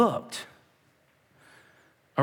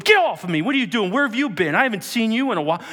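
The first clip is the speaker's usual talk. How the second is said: raised, irritated scolding; mock angry rebuke; 5 semitones higher, 3 dB louder, faster than usual. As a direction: snap at someone grabbing you. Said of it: "A Jesus went".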